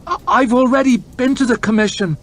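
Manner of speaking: high, loud pleading cry; a frightened last appeal; desperate stammer. Acted. Farmer desperately begging the lord chamberlain not to dismiss him. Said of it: "he stammered".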